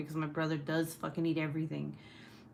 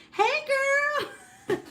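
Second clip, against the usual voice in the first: much higher, louder fake-friendly hello; fake sweet greeting; 6 dB louder than usual. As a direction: switch to sugary fake friend with a laugh. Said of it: "We're just like".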